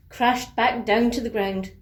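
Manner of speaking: usual speaking voice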